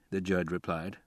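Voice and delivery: ordinary delivery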